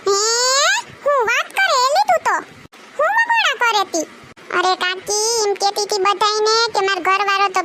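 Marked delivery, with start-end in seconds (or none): 0.1-4.1 surprised. Acted. Aunt alarmed at news.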